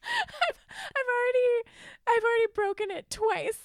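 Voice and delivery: high, loud mock wail of despair; hammed-up crying confession. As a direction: wail it like a comic lament. Mock anguish confessing broken resolution.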